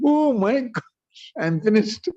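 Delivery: high, slow, panicked cry of dread; a man sure he is doomed, voice cracking high; terrified gasp. Retold or imitated. Chandkazi hears the master is coming, terrified. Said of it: "He became so afraid".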